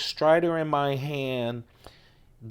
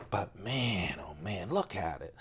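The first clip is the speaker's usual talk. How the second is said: breathy hush, much softer; awed whisper; 6 semitones lower, 12 dB quieter than usual. Real, not acted. Vlogger admiring his Strider, whispering in awe. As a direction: whisper it like the knife is sacred.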